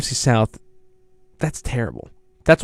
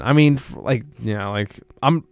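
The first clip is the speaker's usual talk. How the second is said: normal talking voice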